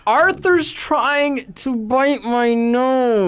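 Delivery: talking through clenched teeth; gritted teeth. Retold or imitated. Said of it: "through gritted teeth".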